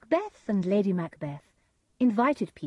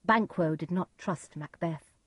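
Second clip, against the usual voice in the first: usual speaking voice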